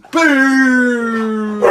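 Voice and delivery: sudden loud shout; startling boo. Real, not acted. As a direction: jump out and yell boo. Jump-scare from hiding spot.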